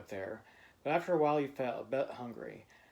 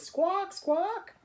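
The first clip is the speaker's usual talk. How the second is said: very high, loud squawks; bird squawk; 5 dB louder than usual. A narrator's reading. Child trapped as a bird, squawking: high loud squawk. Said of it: "all you could say was".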